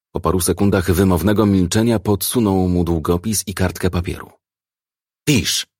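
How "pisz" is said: short, higher-pitched growled order. A narrator's reading. Officer sliding paper to the suspect, growled. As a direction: growl the command curtly. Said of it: "warknął".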